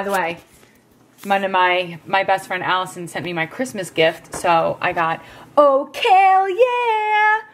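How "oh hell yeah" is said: much higher, slow drawn-out cheer; thrilled hell yeah; excited. Real, not acted. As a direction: stretch it out in delight. Excited over gift.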